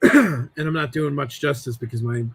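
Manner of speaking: voice about cracking. Real, not acted. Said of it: "my voice is about cracking".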